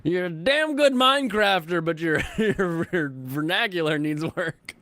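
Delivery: high, laughing teasing tone. Real, not acted. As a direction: tease while laughing.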